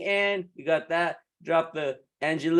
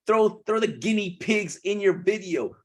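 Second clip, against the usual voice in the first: usual speaking voice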